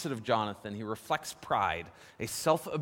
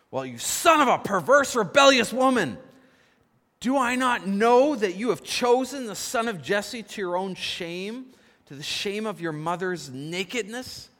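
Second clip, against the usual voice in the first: roared curse, much higher and louder; furious shout; 7 semitones higher, 9 dB louder than usual. Retold or imitated. Saul cursing Jonathan at the dinner table, furious shout. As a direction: bellow it at your son in rage. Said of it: "Saul's anger was kindled".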